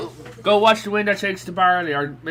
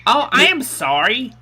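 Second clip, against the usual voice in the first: slow, higher, over-enunciated mock apology; exaggerated careful talk; 5 semitones higher than usual. Irish panelist mocks friends who can't follow the accent, slow and over-clear. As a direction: spell it out slowly for slow listeners. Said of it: "talk like this".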